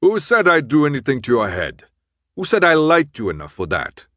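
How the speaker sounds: gruff man's loud, indignant outburst; angry. A narrator's reading. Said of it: "then slapped the table".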